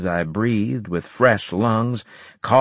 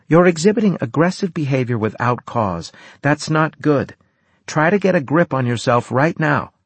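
stern clinical warning; 7 semitones higher than usual. Acted.

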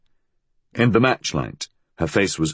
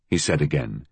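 ordinary delivery, nothing marked

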